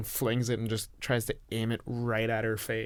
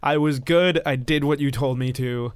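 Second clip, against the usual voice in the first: louder, higher raging yell; screaming in rage; 4 semitones higher, 8 dB louder than usual. Acted. Ron screaming at the creature he thought was his mother, raging yell. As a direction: scream it at her with the hurt boiling over. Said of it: "screaming at this alien creature".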